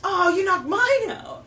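gasp, then high surprised cry; sharp gasp of surprise. Real, not acted. Dart hits her dart.

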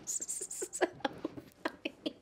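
voiceless, breathy aside; whisper. Real, not acted. To herself, whispering during a giggle fit.

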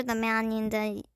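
ordinary delivery

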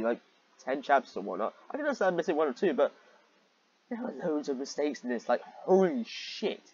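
ordinary talk, nothing marked

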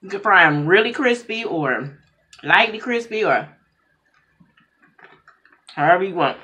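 speaking with food in mouth; mid-bite mumbling speech; chewing while talking. Real, not acted. Seen 2: Eats noodles by hand throughout, mouth working between bites while speaking.

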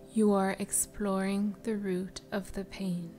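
normal talking voice

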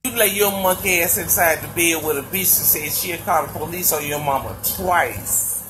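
ordinary talk, nothing marked

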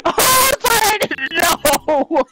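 high-pitched, drawn-out helpless laughing wail; laughing hard. Real, not acted. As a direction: squeal it slowly through real laughter. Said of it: "Why am I laughing so hard".

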